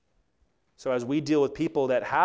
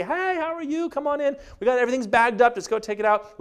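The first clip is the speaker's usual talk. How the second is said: much higher, bright welcoming mom voice; sweet mom; 12 semitones higher, 7 dB louder than usual. Retold or imitated. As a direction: greet the movers like a sweet hostess. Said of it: "she was just the nicest lady".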